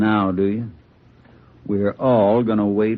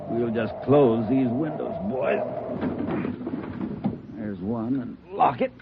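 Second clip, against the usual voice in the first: much softer, to himself; quiet, muttered; 2 semitones higher, 7 dB quieter than usual. Acted. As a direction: mutter while closing windows. Gunman quietly closing windows.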